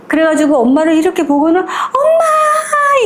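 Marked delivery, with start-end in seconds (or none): 1.6-3.0 long, high calling out; joyful cry. Retold or imitated. Little girl calling her returning mother, long high cry. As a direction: cry out and run into her arms.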